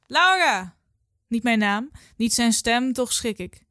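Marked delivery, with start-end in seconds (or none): 0.1-0.8 loud, high call across the campsite. A narrator's reading. Someone on the campsite calls a name loudly. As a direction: shout the name from afar. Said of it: "roept iemand".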